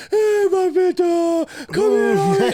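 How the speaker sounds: very high, loud, drawn-out cooing to a pet; mock wife gushing over her bird; imitated doting wife. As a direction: squeal it sweetly, slow and loud. Wife acted greeting the pet bird.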